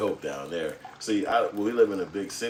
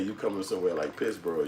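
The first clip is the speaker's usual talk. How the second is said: normal talking voice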